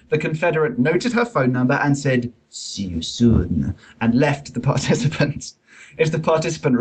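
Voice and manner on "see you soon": slow, low, hammed-up French accent. The confederate leaving with her number, slow French accent. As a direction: drawl it like a French charmer, thick French accent. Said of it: "I shall read in exaggerated French accent".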